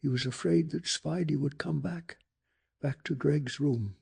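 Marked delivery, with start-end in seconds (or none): none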